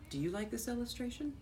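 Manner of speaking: plain reading voice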